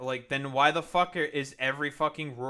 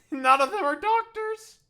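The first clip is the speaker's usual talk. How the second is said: very high, loud exclamation; incredulous yell; 8 dB louder than usual. Real, not acted. Streamer reacting to non-doctors giving medical advice, incredulous yell.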